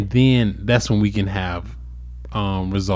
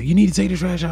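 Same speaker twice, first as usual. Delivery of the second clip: high-pitched nagging retelling; nagging demand; 8 semitones higher, faster than usual. Retold or imitated. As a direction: imitate a partner nagging about chores. Acting out a trash argument.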